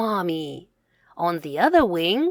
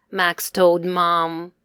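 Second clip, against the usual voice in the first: plain reading voice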